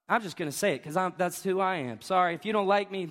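normal talking voice

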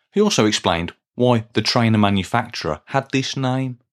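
ordinary delivery

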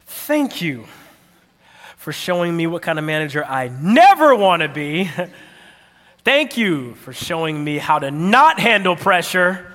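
backhanded thanks, punching the insult; Jimmy Fallon. Acted. Writing a backhanded thank you card, sarcastic. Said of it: "Jimmy Fallon style".

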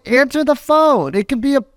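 high, pressing voice, pleading with him to pick up; anxious urging. Retold or imitated. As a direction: urge him frantically to answer his phone. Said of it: "I'm like".

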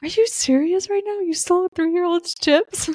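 high-pitched scandalized disbelief; mock incredulous. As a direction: confront him in playful outrage. Acting mom confronting chip thief.

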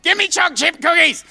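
put-on high, loud toddler demand; bossy little kid, no please; a three-year-old ordering his mum.

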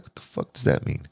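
breathy unvoiced whisper of confusion; a hushed, baffled whisper; whispered aside. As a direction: whisper it in disbelief. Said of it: "And I'd be like".

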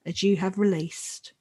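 normal talking voice

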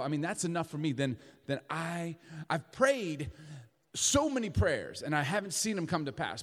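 ordinary talk

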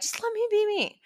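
a light, cheeky whine; playful pleading. Real, not acted.

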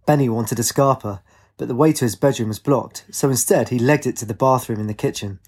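usual speaking voice

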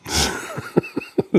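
breathy high-pitched chuckle; high laugh. Real, not acted. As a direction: let out a high airy laugh. Laughing at the threat.

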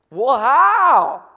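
high, loud, drawn-out "how"; loud blurted question. Acted. Listener interrupting the call to pray, loudly baffled.

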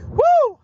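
very high joyful whoop; preacher's ecstatic woo; excited whoop. Real, not acted. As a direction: let out a big holler. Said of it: "what's more exciting than that".

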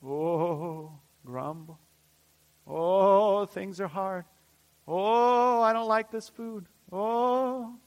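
hammed-up moaning of a grumbling crowd; grumbling Israelites imitated; mock grumbling. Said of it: "they start grumbling".

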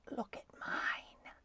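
slow, whispered boast. Acted. Snowbell the cat showing Stuart his teeth, slow whisper. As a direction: draw it out in a very soft whisper.

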